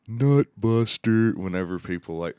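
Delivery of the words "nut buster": loud, higher, drawn-out heckle; imitating brother's dad. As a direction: holler it slowly like a dad watching wrestling. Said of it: "dad would always be like".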